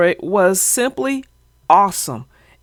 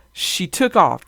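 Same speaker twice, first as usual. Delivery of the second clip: usual speaking voice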